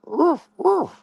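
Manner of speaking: high, yippy mock puppy woofs; puppy bark. Streamer calling his rival a puppy, barking high. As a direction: yip like a small puppy.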